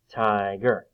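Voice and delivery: "tiger" as two even, stretched beats; split into syllables. Shown as a demonstration. Teacher clapping syllables for children, "tiger" split in two. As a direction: beat out both parts of "tiger". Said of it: "Let's do it".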